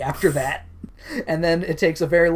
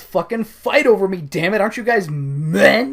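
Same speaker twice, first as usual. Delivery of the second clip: raised, louder shaming yell; mock angry taunt; 4 semitones higher, 5 dB louder than usual. Woman shaming the two men into fighting, mock angry. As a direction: goad them like a furious woman. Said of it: "And she goes".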